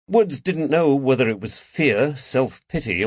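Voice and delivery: usual speaking voice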